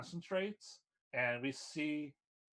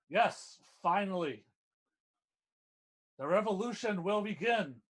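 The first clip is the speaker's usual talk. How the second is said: louder, higher revolutionary proclamation; triumphant young villain; 6 semitones higher, 5 dB louder than usual. Acted. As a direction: declare the takeover like an anime villain. Game master voices gloating young villain.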